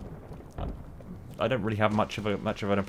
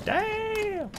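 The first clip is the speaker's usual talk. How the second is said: surprised exclamation. Real, not acted. Sudden game moment, exclamation.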